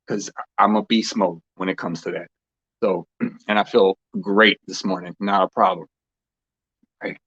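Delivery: ordinary delivery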